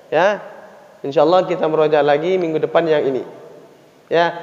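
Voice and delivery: ordinary talk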